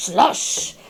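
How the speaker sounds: ordinary delivery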